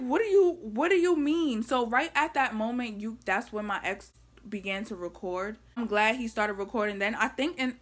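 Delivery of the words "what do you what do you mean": high, raised-voice retold disbelief; incredulous protest. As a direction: act out baffled pushback at an officer. Baffled protest to officer, retold.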